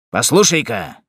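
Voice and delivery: usual speaking voice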